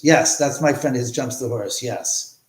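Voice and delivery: ordinary talk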